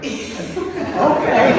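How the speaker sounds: bursts out laughing, high and loud. Real, not acted. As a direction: laugh at yourself. Laughs at her own scare.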